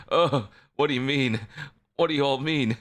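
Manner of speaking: high flustered disbelief. Acted. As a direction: squeak out a baffled denial. Flustered reply to chat.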